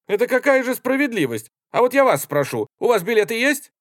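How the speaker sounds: high, loud, agitated appeal; indignant protest. A narrator's reading. Kapelbaum protests to the soldiers about being thrown out, indignant. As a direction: protest with mounting volume and pitch.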